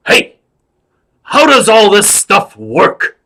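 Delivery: loud, high, drawn-out star shout; Al Pacino. Acted. Movie star startles a clown at his props, loud impression. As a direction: bellow it slowly, pitched well up. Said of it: "Al Pacino impression".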